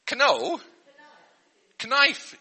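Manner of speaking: "Knife" with the K spoken, k-naif; sounding out knife. Shown as a demonstration. Teacher showing children a wrongly sounded K. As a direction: say "Knife" with a hard K at the front. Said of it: "We don't say knife".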